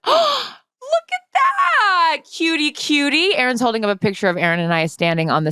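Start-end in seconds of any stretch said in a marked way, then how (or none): 0.1-3.4 gasp, then very high, very slow "cutie, cutie"; real delight at a sweet photo. Real, not acted. Host seeing a photo of her friend, real delighted cooing.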